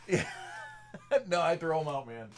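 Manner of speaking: real laugh, then loud words through it. Real, not acted. Laughs at a chat joke.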